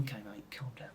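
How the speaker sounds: barely voiced, very soft whisper; muttered aside. Fed-up extra to his neighbour, whispered aside. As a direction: whisper it under the breath.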